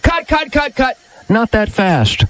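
high director calling cut. Retold or imitated. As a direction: stop the take like an impatient director. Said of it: "And they went".

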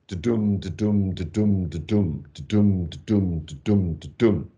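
steady da-dum pulse, stress on every dum; rhythmic heartbeat chant. Shown as a demonstration. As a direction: tap out the beat of a living heart. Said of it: "which is the rhythm of the living".

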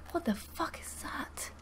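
hushed, breath-only alarm; scared whisper. Real, not acted. Scared player spots something unknown in the game, scared whisper. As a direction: whisper it in real fear. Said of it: "I'm really scared".

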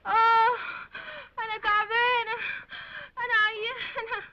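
hammed-up sickly whimpering; ailing moan. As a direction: wail like a melodramatic patient. Patient moans that she is ill.

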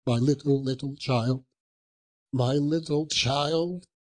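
grieving father. Acted. Bob tells his wife of the grave, grief breaking through. Bob speaks of Tiny Tim.

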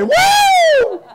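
high, long "woo"; loud falsetto-high cheer; whoop. Shown as a demonstration. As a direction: let out a soaring revival whoop. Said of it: "say it".

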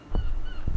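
high startled gasp; sharp gasp. Real, not acted. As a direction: gasp as it comes close. Monster approaching.

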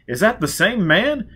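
high, loud society voice; high society gossip. Acted. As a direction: ask it like a scandalised socialite.

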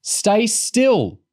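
high, loud, desperate order; desperate shout. A narrator's reading. Girl to struggling heart-attack patient, desperate shout. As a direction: plead loudly with him to stop. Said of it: "Bethany shouted desperately".

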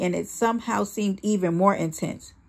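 ordinary delivery

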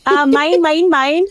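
high, sing-song mine, mine; playful gloating. Real, not acted. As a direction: crow about going first. Claiming first turn playfully.

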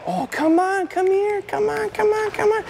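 very high, gushing come on calls; excited puppy call. Shown as a demonstration. Trainer calling the puppy over, very high excited coaxing. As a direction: coax the puppy in with squeaky delight. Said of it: "I get right down and I go".

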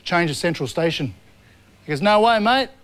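ordinary talk

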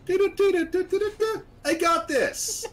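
very high, loud mock anime fanfare and catchphrase; sing-song intro bursting into a drawn-out squeal; anime character. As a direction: imitate a little anime hero before a challenge. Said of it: "like a little anime character".

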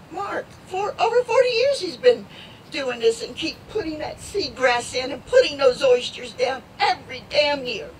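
crying through the words, real; choked up, fighting back tears; emotional. Real, not acted. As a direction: voice breaking into tears. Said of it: "I didn't mean to get emotional".

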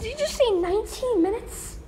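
can't believe the wait; incredulous. Real, not acted. Train announces nineteen minute wait.

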